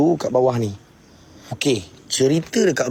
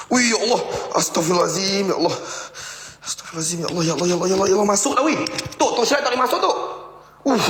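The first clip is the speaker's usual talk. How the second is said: much higher, frantic calls to God; panicked cry; 8 semitones higher, much faster than usual. Real, not acted. Something seems to come in, he cries out in panic to God.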